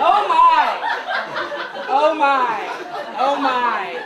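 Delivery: loud, fading oh my; shocked gasp. Real, not acted. Comedian reacting in shock to grandma's line, loud. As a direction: gasp in mock horror, then fade.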